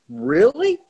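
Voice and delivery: high, loud mocking doubt; sceptical really. Friend mocks Daryl's claim to be a top athlete, loud and sceptical. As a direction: scoff at the boast, pitch jumping up.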